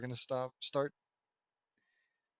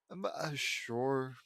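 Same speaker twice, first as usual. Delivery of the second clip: ordinary talk, nothing marked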